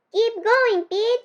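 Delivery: urging cry. A narrator's reading. Mia cheers Pete on toward the boulder, urging. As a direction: egg him on. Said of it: "Mia cried".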